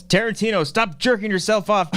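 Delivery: high mock yell at the screen. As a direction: call it out like a heckler.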